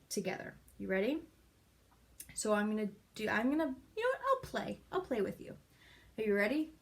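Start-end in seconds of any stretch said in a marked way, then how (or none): none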